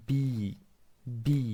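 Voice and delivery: ordinary talk, nothing marked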